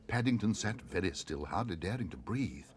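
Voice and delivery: usual speaking voice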